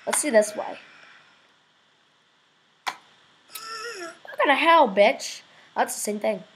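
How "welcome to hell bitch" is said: high, drawn-out mock greeting; put-on greeting. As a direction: stretch it out slowly in a higher voice. Repeats devil greeting in another voice.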